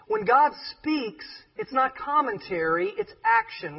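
rising preacher emphasis; preaching emphasis. Acted. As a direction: drive the point home from the pulpit.

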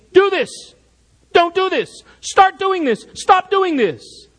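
high, sharply stressed commands; emphatic parental orders. Acted. Parent securing a child's well-being, emphatic commands. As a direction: lay down the law in short separate orders. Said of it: "takes on a more emphatic tone".

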